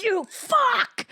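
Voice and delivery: high, sharp yell at the stairs; mock angry yell; angry curse. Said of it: "and I'm like".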